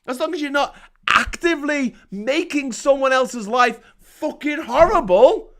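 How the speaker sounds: usual speaking voice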